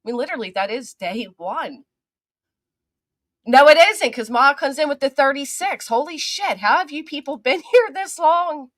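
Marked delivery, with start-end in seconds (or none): 3.5-8.7 high, loud, bursting with disbelief; amazed excitement. Real, not acted. Real delighted astonishment. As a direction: streamer gasps at a thirty-six month member, excited and high. Amazed at thirty-six month member.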